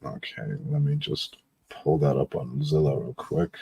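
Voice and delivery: ordinary delivery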